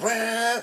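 high-pitched car revving noise; imitated engine roar. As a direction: mimic a car ripping past. Imitates car ripping down road.

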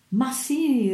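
high, energetic protest; forceful insistence. A narrator's reading. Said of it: "riprese con sovrumana energia".

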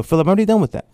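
high, put-out objection; protesting. Retold or imitated. Artist protesting to Philip, high and put out. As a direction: push back, a bit offended.

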